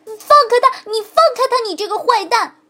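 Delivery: high, loud shout. A narrator's reading. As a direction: yell at the fox to let go. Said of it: "骨头叫道". (骨头冲着狐狸高声大喊)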